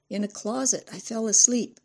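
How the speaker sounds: put-on high, forlorn child voice; little girl. A narrator's reading. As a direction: confess in a small girl's voice. Child answers from closet.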